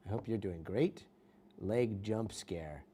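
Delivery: ordinary delivery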